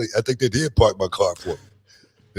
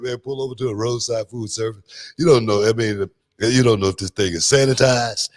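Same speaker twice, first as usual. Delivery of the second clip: ordinary talk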